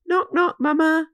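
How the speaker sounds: playful knock knock. Acted. Wee enters studio.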